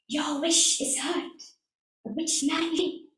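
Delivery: mysterious spirit voice. Acted. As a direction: speak like a hushed mountain spirit. Mountain spirit answers.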